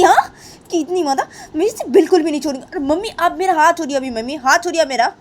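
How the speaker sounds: indignant daughter, voice climbing as she protests; angry Simran. Acted. Simran demands her mother let go of her hand, angry. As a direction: build from firm protest to high, heated anger. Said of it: "क्या इतना गुस्सा कर रही हो तुम सिमरन".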